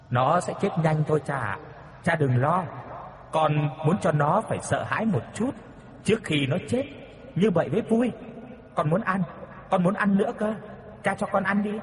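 put-on whiny ghost child begging; wheedling child. Ghost child begs its father for a reward, wheedling. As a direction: coax father sweetly like a spoiled kid.

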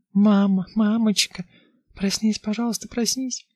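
put-on very high, pleading child voice; scared little girl. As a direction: beg like a frightened child. Little girl waking mother.

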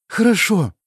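high squeaky reply. Acted. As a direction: squeak it out timidly. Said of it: "пропищала она".

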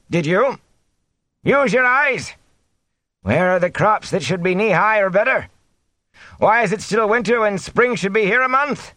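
high-pitched, defiant villager. A narrator's reading. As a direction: argue defiantly over a hostile crowd. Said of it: "and raised his voice".

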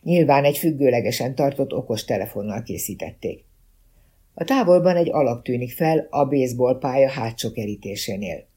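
ordinary delivery

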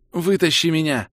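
high, urgent cry for help; panicked plea. Acted. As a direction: beg to be pulled out. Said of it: "паническое сообщение".

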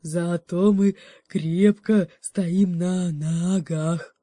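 put-on slow, slurred drunken bear voice; a tipsy bear insisting he stands firm; slurring bear. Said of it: "заплетающимся голосом". (медленно, заплетающимся языком, как пьяный медведь)

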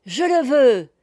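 loud, high, eager exclamation; joyful assent. A narrator's reading. Noël agreeing to become knight of the sea, joyful assent. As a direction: accept with delight. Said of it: "répondit Noël avec joie".

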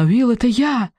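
high, pleading cry; high anguished plea. A narrator's reading. Stella begs wounded Will to hear her, high and pleading. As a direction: make him hear you.

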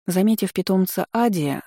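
plain reading voice

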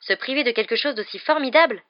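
loud, high incredulous protest; aghast. A narrator's reading. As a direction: exclaim at the sheer waste. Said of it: "un regard effaré".

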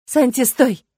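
desperate shout. Acted. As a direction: cry out to stop him. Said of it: "крикнула она".

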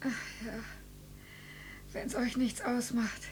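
very soft, faint voice; feeble request. Acted. Dying mother asking family for the television, feeble and soft. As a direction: ask meekly with fading strength.

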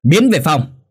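loud, high-pitched dismissal; explosive acted shout; angry shout. A narrator's reading. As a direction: yell it as a furious order. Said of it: "Kha hét lên".

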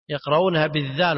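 ordinary talk, nothing marked

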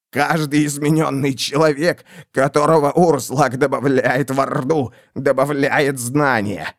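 gurgling through bloody phlegm; wet, choking dying voice; coughing up blood. Acted. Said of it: "сквозь пузыри красной мокроты".

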